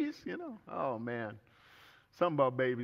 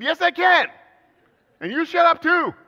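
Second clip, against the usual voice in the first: high, loud comic retort; yelling back; 18 dB louder than usual. Retold or imitated. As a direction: shout it back across the house, very high. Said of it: "And I said".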